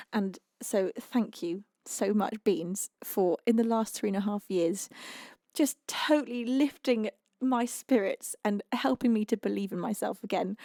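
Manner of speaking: ordinary talk, nothing marked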